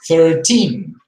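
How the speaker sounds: put-on "Thirteen" with teen punched loud; second syllable stress. Shown as a demonstration. Teacher stressing the teen syllable. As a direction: lean hard on the teen of "Thirteen". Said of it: "stressing the second syllable".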